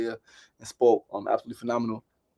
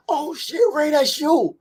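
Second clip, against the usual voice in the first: much higher, excited gasp of surprise; mother surprised; 13 semitones higher, slower than usual. Retold or imitated. Mother sees his photo on the wall: high surprised cry. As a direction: mom spotting him on the screen. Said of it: "My mother catches it".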